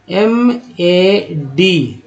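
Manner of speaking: very slow letter by letter; spelling out letters. Shown as a demonstration. Teacher spells mad slowly for students. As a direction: spell एम ए डी drawn out. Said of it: "क्या उच्चारण करते हैं".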